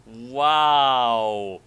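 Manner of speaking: normal talking voice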